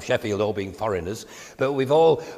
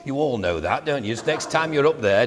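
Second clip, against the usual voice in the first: usual speaking voice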